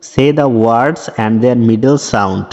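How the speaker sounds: very slow, careful English reading; slow read-aloud. Shown as a demonstration. Teacher reading the textbook instruction slowly to pupils. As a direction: spell it out slowly for the class.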